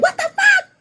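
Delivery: very high, squealed outcry; comic high-pitched shock; mock outraged disbelief. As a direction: shriek it in playful disbelief. Acts out listener's coming outraged reaction.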